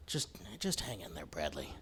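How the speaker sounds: very soft, hushed murmur to a dying cat; hushed comfort. Retold or imitated. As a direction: murmur it so only the cat hears.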